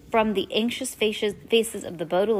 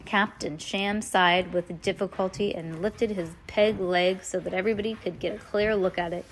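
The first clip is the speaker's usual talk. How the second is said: plain reading voice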